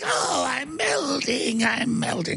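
high, slow melodramatic wail; sinking, drawn-out fake dying cry; mock death wail. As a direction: wail as if dissolving, hammed up. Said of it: "and like".

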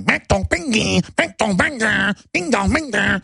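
high sing-song bing dong chant; imitated childish babbling. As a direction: mimic a child's repetitive babble, pitched high.

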